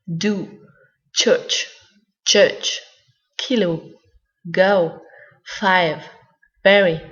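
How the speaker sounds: each English word said alone, slowly and clearly, like a teacher's demo; careful English word list. Shown as a demonstration. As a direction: read each example word with clean English sounds. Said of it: "premier symbole". (liste de mots anglais articulés clairement, un par un)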